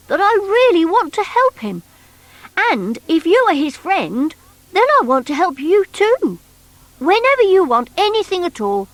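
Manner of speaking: high, funny little creature voice; bunkie's voice. Acted. Bunkie telling Noddy about himself, high creature voice. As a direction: squeak it out like a hurt half-rabbit.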